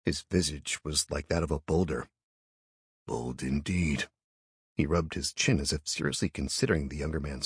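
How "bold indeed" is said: low and slow. A narrator's reading. Champion speaks, stony.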